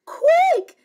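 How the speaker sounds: high, loud panicky cry; high-pitched urgent command; urgent order. Acted. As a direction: shriek it in sudden alarm. Panicked mummy, threatened with tears.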